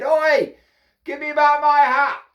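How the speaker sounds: high, loud holler; shouted demand. A narrator's reading. Hatter baiting the copycat monkeys, shouted demand. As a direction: call up sharply into the trees.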